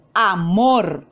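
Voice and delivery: amor with the force on the final mor; stress on MOR. Shown as a demonstration. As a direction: say amor leaning on its second syllable, mor. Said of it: "La mayor fuerza de voz". (amor, con fuerza de voz en mor)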